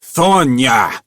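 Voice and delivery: loud bellow of a name. A narrator's reading. Manager bellowing for the janitor, loud. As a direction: bellow his name up the aisle. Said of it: "found his bellow again".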